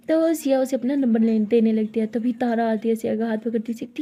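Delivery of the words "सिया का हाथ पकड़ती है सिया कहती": ordinary delivery, nothing marked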